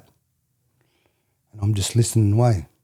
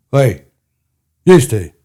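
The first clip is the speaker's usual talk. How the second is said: louder, a mock stern call; 4 semitones higher, 7 dB louder, slower than usual. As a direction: hail two boys like a policeman. Cop calling them out.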